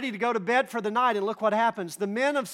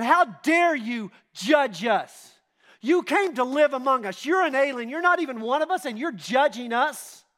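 louder, higher indignant mob shout; angry mob; 6 semitones higher, 6 dB louder than usual. Retold or imitated. The men of Sodom turn on Lot, loud and higher with outrage. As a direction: hurl it back at him, outraged and loud. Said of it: "And they said".

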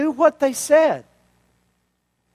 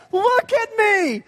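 much higher, slow and gleeful; delighted show-off; 10 semitones higher, slower than usual. Acted. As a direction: beam as you show yourself off. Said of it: "Look what he says".

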